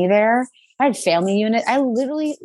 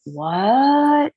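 shocked, near tears; 7 semitones higher than usual. Retold or imitated. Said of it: "instantly started crying".

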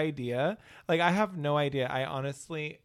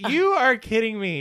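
incredulous; 7 semitones higher, 8 dB louder than usual. Retold or imitated. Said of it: "She's like".

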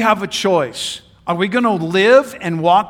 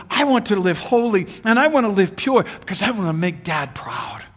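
normal talking voice

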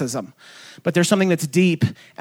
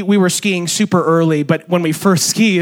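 ordinary talk, nothing marked

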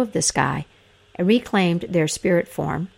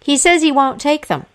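raised, high calling voice; loud announcement; 8 semitones higher, 5 dB louder than usual. A narrator's reading. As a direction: call it out loudly and high. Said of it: "said loudly".

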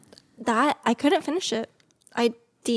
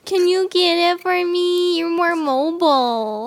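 mock whiny request; 8 semitones higher, 8 dB louder than usual. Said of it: "she's gonna be like".

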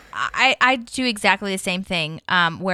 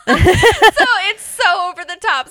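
higher, louder, slowed by laughter; laughing; 9 semitones higher, 7 dB louder than usual. Real, not acted. Laughing with her co-host about the accent. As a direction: talk through real giggles. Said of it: "laughing while speaking".

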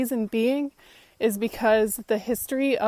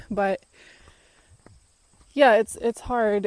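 ordinary talk, nothing marked

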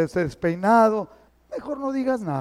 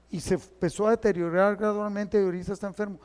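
ordinary talk, nothing marked

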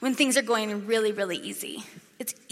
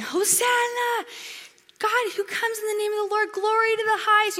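high, ringing cry of praise; crowd cheering; 11 semitones higher than usual. Retold or imitated. Preacher voicing the Bethany crowd hailing Jesus, high exultant cry. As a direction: call it out like a jubilant crowd.

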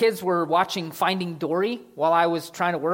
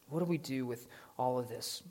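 "What do we do with all of this?" asked again much softer; the question repeated softly so it sinks in; quiet repeat; 4 semitones lower, 10 dB quieter than usual. Shown as a demonstration. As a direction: drop it to a reflective hush. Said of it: "So what do we do with all of this?".

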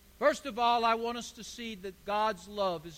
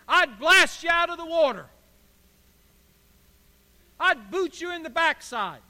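higher and louder, a mock tirade; mock blasting; 5 semitones higher, 7 dB louder than usual. Pastor imagining how he'd scold an apathetic congregation, mock fury. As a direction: hammer them as a harsh pastor would. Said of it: "don't look at me so spiritual".

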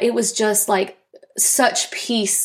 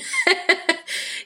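laugh; 10 semitones higher than usual. Real, not acted. Friend's Harry Potter remark.

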